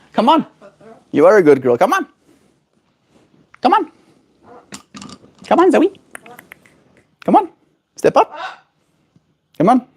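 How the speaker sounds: high sing-song coaxing of a bird; pet talk. Real, not acted. Calling pet bird to step up.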